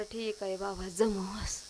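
very soft, low giving-in; subdued reply. Retold or imitated. Traveller answers confusing directions, in a low subdued murmur. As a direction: drop right down, quiet and resigned.

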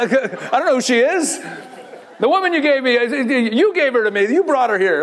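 hammed-up guilty husband, laughing through it; whiny excuse-making Adam. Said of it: "And now he's like".

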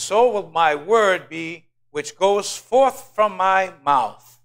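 loud, very slow declaration; solemn scripture proclamation. Acted. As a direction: intone God's words with weight. Quoting God solemnly.

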